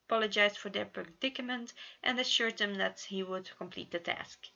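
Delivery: ordinary delivery, nothing marked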